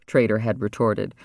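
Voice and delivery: usual speaking voice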